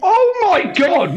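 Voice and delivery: laughing high and slow. Real, not acted. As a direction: laugh it out in disbelief. Reacting to the game choice.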